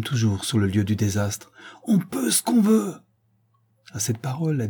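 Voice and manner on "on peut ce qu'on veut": ordre lancé très haut, impérieux; appel impérieux. A narrator's reading. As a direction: exhorter la foule d'une voix qui commande. Le souverain harangue les pompiers. (le souverain exhorte les pompiers, voix impérieuse et haute)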